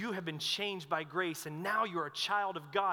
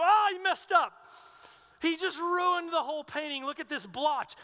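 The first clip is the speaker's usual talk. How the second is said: much higher and louder, aghast; shocked dismay; 9 semitones higher, 8 dB louder than usual. Retold or imitated. College roommates watching the painting get ruined, loud shocked dismay.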